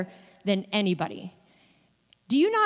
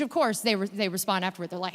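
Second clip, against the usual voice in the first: ordinary talk, nothing marked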